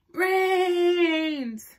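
high, loud sing-song wail; zombie cry. Acted. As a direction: call out the bait to the horde. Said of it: "cried Reginald".

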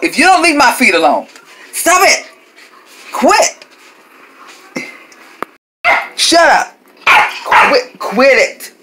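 high, loud scolding yells; yelling at dog. Real, not acted. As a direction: shout the dog off your feet. Yelling at dog on her feet.

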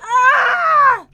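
startled scream. Real, not acted. Player near the angry queen, screaming. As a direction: scream in sudden alarm.

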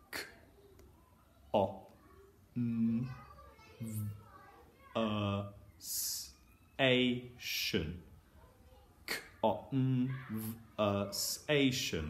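K, O, N said as separate short sounds; sounding out phonemes. Shown as a demonstration. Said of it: "you say the sounds".

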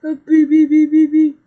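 loud "bee, bee" chant; loud silly beeping. Acted. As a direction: blast a goofy beeping noise. Silly noise while playing.

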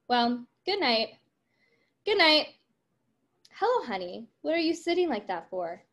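lively read-aloud of the script's dialogue, voices lightly acted; reading a play aloud. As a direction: read the scene to the class, giving the lines some life.

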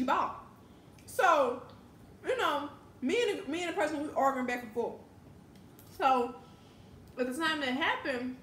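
talking between bites; chewing while talking. Real, not acted. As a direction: mouth partly full. Seen 3: bite taken at -1.0; head down eating, mouth working while speaking.

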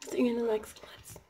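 whispered sign-off; hushed goodbye whisper. Real, not acted. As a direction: whisper it so no one hears. Signing off a clip.

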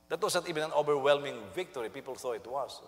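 ordinary delivery

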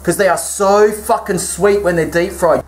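usual speaking voice

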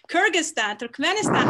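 normal talking voice

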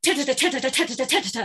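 rapid crisp T triplets; tripled T beats. Shown as a demonstration. Coach showing tripled T rhythm to the class. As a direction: rattle the Ts off like a snare roll. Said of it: "triple every one of those parts".

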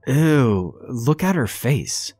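delighted to be grossed out; amused disgust. A narrator's reading. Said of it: "clearly entertained".

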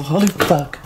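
ordinary talk

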